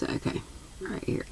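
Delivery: sleepy whisper to herself. Real, not acted. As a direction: whisper it, half asleep. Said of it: "It's just a few more pages".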